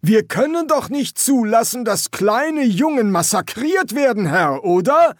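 loud, high, projected sergeant's voice; forceful sergeant Jackram. Acted. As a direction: make the case with parade-ground force. Feldwebel Jackram redet zum Leutnant. (lauter, nachdrücklicher Feldwebel)

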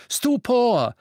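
high, loud spell cry; shouted spell. A narrator's reading. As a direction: fire the spell out. Harry casts a spell.

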